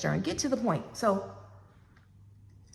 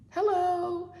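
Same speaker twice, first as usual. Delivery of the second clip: bright, much higher telephone greeting; sing-song phone hello; 9 semitones higher than usual. Retold or imitated. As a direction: answer the unknown caller sweetly. Answering a stranger's call.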